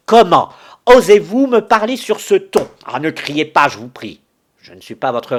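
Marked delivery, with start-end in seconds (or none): 0.1-3.7 high, loud indignant cry; outraged shout. Acted. Widow rounds on the rude visitor, shouting in outrage. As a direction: explode at the insult, pitch flying up.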